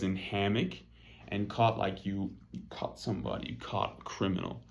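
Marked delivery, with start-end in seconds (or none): none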